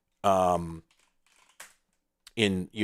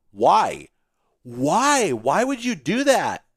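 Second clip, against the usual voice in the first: exasperated; 7 semitones higher, 5 dB louder, much slower than usual. Real, not acted. Ranting at the weird keybinds.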